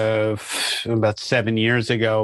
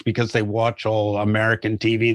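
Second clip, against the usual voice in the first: usual speaking voice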